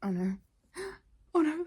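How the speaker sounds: theatrical gasp of dread; mock dread. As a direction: act out reading a heartbreaking line. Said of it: "lines where I'm just like".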